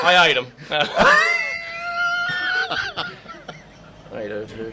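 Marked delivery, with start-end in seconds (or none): 0.5-3.6 high, loud, almost crying laughter; howling laugh. Real, not acted. Comic cracking up at his own crude joke, howling. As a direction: laugh till you nearly cry.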